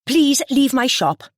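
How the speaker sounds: high, slow and firm request. A narrator's reading. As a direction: show her the door, no arguing. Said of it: "she said firmly".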